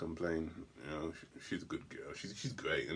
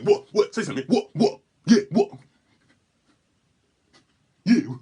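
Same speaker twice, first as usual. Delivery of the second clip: louder and higher, pumped-up confrontational barking; crazy aggressive act; 8 semitones higher, 12 dB louder than usual. Acted. Confronting the rude driver, loud aggressive act. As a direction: get in his face like you're crazy. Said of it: "Just get crazy".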